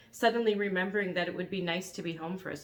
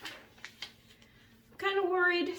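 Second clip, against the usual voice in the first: worried; 12 semitones higher, much slower than usual. Acted. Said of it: "said the dragon".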